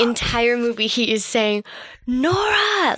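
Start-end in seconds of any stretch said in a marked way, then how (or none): 2.1-2.9 high-pitched mock yell; Marty. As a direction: imitate someone calling out for her. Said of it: "Like he's yelling for her".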